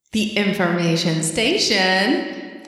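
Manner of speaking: slow, drawn-out title with rising end; hammy, lingering segment title; playful segment announcement. Pretend. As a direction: stretch each word like a show intro.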